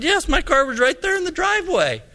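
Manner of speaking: high-pitched mock protest; retold defensive retort. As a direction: argue back, acting out the quarrel. Pastor argues back.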